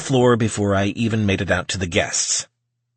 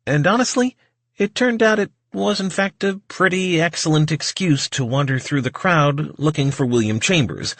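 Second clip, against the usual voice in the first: ordinary delivery, nothing marked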